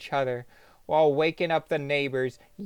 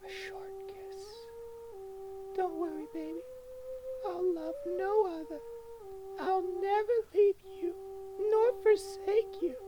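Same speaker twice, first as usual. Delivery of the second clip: higher, softer, tearful plea; sobbing lady; 12 dB quieter than usual. Acted. The lady sobs to her battered husband, tearful. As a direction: cry it over your dying love. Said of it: "She sobs".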